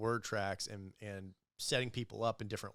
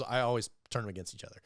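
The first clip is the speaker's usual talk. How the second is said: ordinary talk, nothing marked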